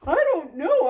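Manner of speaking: loud, wavering character voice; distressed Gerald. Acted. Gerald answers his friend before sneezing, distressed. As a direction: play a character about to sneeze.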